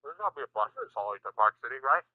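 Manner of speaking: talking while chewing; words round a bite of food; mouth full. Real, not acted. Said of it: "[name], chew your food".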